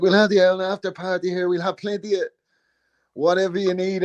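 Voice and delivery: high-pitched mimicked Dublin host offering a party; McGregor impression. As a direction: imitate an eager host, voice held high. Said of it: "He's like".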